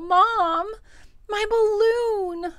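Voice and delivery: put-on high, loud, stretched-out child's wail. A narrator's reading. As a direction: call out to mom in acted distress. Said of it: "I wail".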